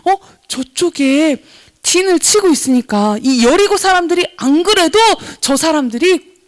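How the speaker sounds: ordinary talk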